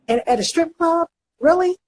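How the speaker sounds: high, disbelieving really; incredulous. Real, not acted. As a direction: ask in outraged disbelief. Incredulous rhetorical question.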